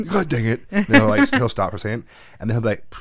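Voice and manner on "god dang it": loud, high mock frustrated yell; frustrated outburst. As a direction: bark it like a kid tripping up. Imitating son's frustrated outburst.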